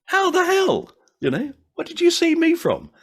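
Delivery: high, laughing mock disbelief; astonished reply. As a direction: act floored that a stranger knows you. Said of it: "You go".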